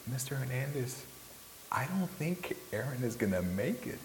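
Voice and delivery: small voice gently breaking bad news; sweet, soft girl. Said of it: "sweet, soft voice of a girl".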